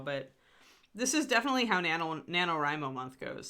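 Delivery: normal talking voice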